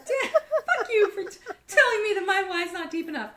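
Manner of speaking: hammed-up indignation dissolving into laughs; laughing mock outrage. Imitating her reply to the mentor, laughing mock outrage.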